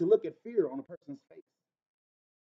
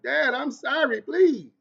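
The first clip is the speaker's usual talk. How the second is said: imitating a scared little girl begging, higher and louder; mock child's plea to her father; pleading child; 6 semitones higher, 4 dB louder, much slower than usual. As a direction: beg dad like a frightened daughter. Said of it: "she was like".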